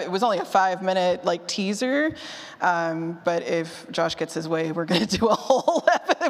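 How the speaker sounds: usual speaking voice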